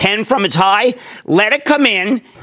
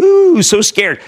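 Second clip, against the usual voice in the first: sarcastic "Ooh, so scared", pitched up and louder; mock the scaredy-cats with a higher voice; 6 semitones higher, 4 dB louder, much slower than usual. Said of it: "which everyone's saying".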